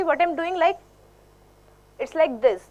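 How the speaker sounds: ordinary delivery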